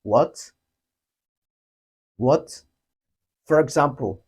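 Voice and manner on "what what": WHAT rising like a question. Shown as a demonstration. Teacher compares English question word, rising WHAT. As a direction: lift the pitch through WHAT. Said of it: "similar with the English question word".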